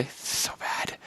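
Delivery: whispered despairing comment; unvoiced whisper aside. Real, not acted. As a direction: breathe it aside to the audience. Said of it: "reader's aside".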